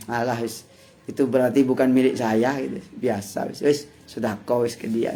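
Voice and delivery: soft, easy-going letting go of the loss; resigned shrug. Retold or imitated. Cheated man shrugging off lost money, softly resigned. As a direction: wave it away gently, no grudge.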